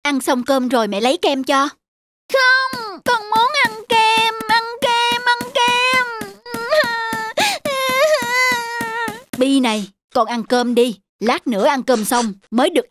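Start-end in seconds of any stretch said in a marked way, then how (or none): 2.3-6.1 put-on high, sing-song whine of a spoiled boy; boy throwing a tantrum at dinner, whining; whining child tantrum. Child tantrum over ice cream. 6.1-9.3 high sulky whimper; boy crying over ice cream; child's whiny fake cry.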